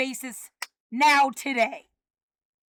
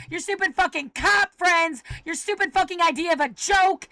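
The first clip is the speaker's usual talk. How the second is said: ordinary talk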